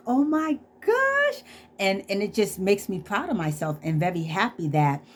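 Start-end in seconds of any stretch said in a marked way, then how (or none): 0.1-1.4 high, slow, overwhelmed oh my gosh; drawn-out thrilled squeal. Retold or imitated. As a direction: stretch it out high with joy. Overwhelmed by his kind words.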